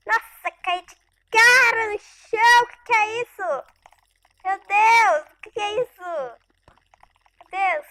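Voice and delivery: high, loud alarmed exclaiming; hit hard crying out. Real, not acted. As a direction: cry out in shock. Startled by fall, monster. (exclamações altas e assustadas)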